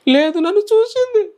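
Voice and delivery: high, slow, tearful wail; a small boy sobbing that his mother is gone; crying like a child. Retold or imitated. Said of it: "అని నేను ఏడుతుంటే". (చిన్నపిల్లాడిలా ఏడుస్తూ)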